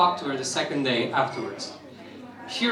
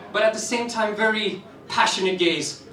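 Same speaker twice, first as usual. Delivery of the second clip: ordinary delivery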